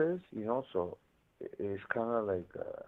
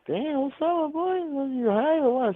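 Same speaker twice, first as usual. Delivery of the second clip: much higher, louder mock-amazed homie voice; Presidente teasing; 5 dB louder than usual. Cellmate asks if he's high, mock amazed high-pitched teasing. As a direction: squeak it up like a buddy ribbing him about being high. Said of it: "Presidente was like".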